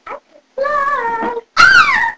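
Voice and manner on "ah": sudden high-pitched squeal; high scream. Acted. Child losing flying powers, high scream. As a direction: shriek way up high. Falls while trying to fly.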